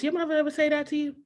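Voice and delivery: ordinary talk, nothing marked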